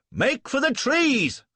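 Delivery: high, loud, slow shouted order; urgent yell. A narrator's reading. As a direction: bellow it across the gateway. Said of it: "I yelled".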